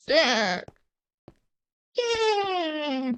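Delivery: a high, cracking whoop of joy; excited yell. Real, not acted. Gamer cheering a win at his screen, in a cracking high whoop. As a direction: let out a triumphant cry.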